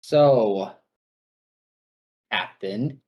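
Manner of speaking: mildly wounded, halting address; hurt feelings. Acted. Locris addressing Renwick after being wounded, hurt feelings. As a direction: let a little hurt into it, with pauses. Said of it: "obviously still hurt feelings in his voice".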